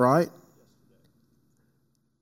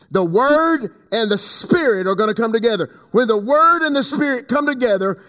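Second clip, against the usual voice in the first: higher, declamatory prophecy delivery; forceful preaching; 6 semitones higher than usual. Acted. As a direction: proclaim it to the whole congregation.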